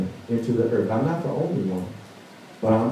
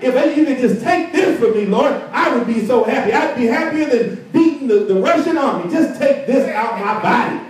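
pleading with the Lord; 10 semitones higher than usual. Retold or imitated. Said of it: "and you're like".

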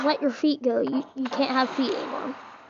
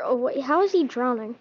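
ordinary delivery

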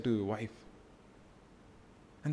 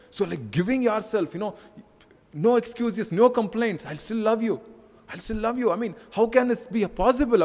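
higher, urgent pulpit voice; impassioned preaching; 6 semitones higher, 3 dB louder than usual. Acted. Preacher urging the husbands in church, fervent. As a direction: preach it with rising fervor.

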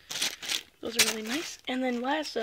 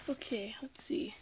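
whispering; 9 dB quieter than usual. Real, not acted. Quietly opening the package.

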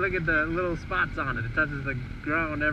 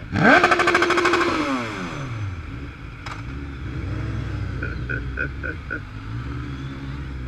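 long laughing fit. Real, not acted.

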